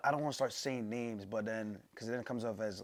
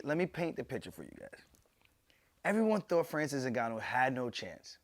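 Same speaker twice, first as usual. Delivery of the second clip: normal talking voice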